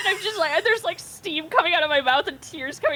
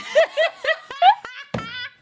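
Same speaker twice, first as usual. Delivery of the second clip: high laugh. Real, not acted. Laughs at herself.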